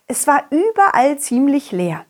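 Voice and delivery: plain reading voice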